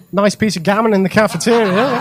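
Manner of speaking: ordinary delivery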